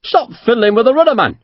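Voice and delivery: high, irritated scolding; angry rebuke. A narrator's reading. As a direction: tell him off sharply. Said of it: "Fairley turned angrily on the captain".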